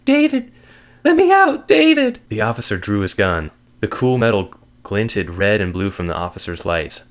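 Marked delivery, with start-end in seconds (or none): 0.0-2.2 high, loud, desperate scream for help; desperate cry. Acted. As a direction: cry out to be let out, frantic. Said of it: "The voice cried".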